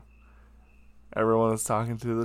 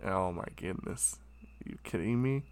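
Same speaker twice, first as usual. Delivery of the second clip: exasperated mutter; 3 semitones lower, 11 dB quieter than usual. Retold or imitated. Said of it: "he was just like".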